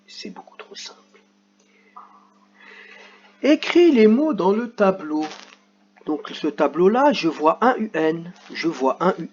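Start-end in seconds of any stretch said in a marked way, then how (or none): 0.1-1.0 whispered teasing aside; conspiratorial whisper to the class. Real, not acted. 3.4-5.2 higher, louder reading of the instruction; reading the worksheet instruction to the class; instruction read aloud. Shown as a demonstration. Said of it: "reading the instruction aloud".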